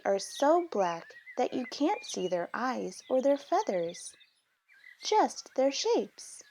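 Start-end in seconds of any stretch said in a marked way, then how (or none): none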